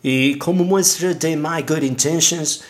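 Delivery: gringo speaking broken Spanish; American accent Spanish. Acted. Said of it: "disculpen mi español".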